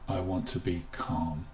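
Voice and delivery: calming. Shown as a demonstration. Teacher showing how to say calm calmingly, soft and low. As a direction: let it settle gently. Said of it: "say it in a way that is calming".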